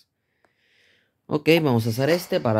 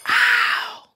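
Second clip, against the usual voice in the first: pained yelp. Real, not acted. Sudden outcry mid battle.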